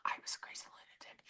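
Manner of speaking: very quiet, quick whisper; voiceless confession; whispered aside. Real, not acted. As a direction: whisper it, spent from laughing. Laughed-out aside about herself.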